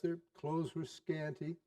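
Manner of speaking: ordinary delivery, nothing marked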